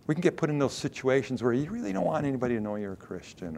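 normal talking voice

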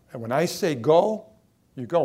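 commanding. Acted. Jesus' commands voiced.

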